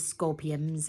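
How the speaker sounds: ordinary delivery, nothing marked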